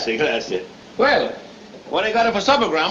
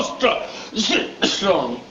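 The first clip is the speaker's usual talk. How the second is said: coughing mid-boast. Acted. Boast broken by his own cough.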